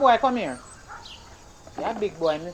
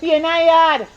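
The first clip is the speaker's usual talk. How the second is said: calling out; 8 semitones higher, 8 dB louder than usual. Acted. Mother yelling order to son.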